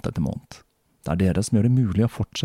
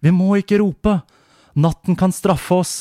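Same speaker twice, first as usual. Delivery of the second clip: much higher, louder frightened warning; a scared mother warning her child about the night; 10 semitones higher, 6 dB louder than usual. Acted. (redd mor, mye lysere og sterkere)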